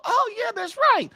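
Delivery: high, mock wife's grudging reply; imitating wife. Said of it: "and she'd go".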